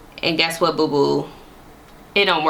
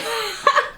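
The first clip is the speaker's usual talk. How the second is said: short high-pitched giggle; a quick high laugh. Real, not acted. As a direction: burst into a squeaky laugh. Reacting to angry texts.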